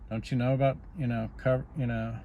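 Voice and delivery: normal talking voice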